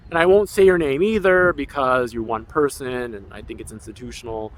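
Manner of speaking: ordinary delivery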